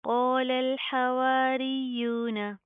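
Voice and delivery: slow chanted tajweed, heavy qaaf; Quranic recitation. Shown as a demonstration. Teacher recites the verse for students to copy. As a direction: chant क़ालल slowly with a thick qaaf. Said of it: "क़ाफ के साथ का अलिफ भी proper मोटा".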